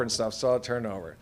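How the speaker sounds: usual speaking voice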